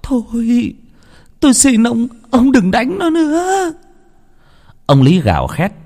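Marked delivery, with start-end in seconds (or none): 0.0-3.7 much higher, crying plea; a wife begging her husband to stop; slow tearful begging; sobbing plea. A narrator's reading. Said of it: "khóc òa lên".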